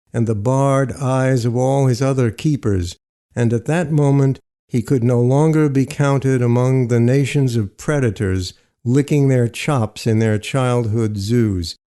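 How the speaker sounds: plain reading voice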